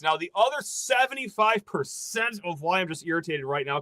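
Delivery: ordinary talk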